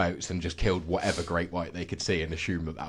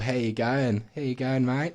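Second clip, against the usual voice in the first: light, everyday Australian greeting; a touch of standard Aussie accent; normal Australian accent; 4 semitones higher than usual. Shown as a demonstration. Showing the normal sort for contrast, mild Australian accent. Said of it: "rather than the normal sort of".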